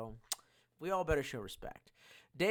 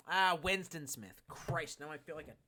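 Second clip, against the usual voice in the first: normal talking voice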